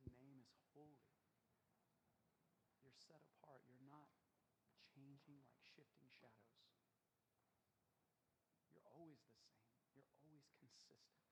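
very soft, intimate prayer voice; hushed worship prayer. Acted. Pastor praying softly to God over guitar: hushed and intimate. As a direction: pray it almost under the breath, devoted.

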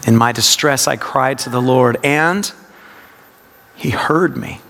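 suspend "and" before finishing the verse; low reading that lifts and hangs on AND; stress on AND. Shown as a demonstration. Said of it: "it's a practice of word emphasis".

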